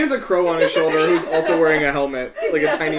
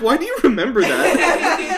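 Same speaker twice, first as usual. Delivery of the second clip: higher, slow disbelieving laugh-question; incredulous; 11 semitones higher, 7 dB louder than usual. Retold or imitated. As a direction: marvel at the odd memory, laughing. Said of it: "And I was like".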